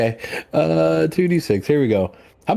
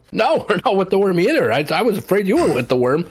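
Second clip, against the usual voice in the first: high-pitched, hurried-sounding protest of innocence; flustered denial; 8 semitones higher than usual. Acted. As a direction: protest in a jumpy, raised voice. Relieved player denies allying with worm.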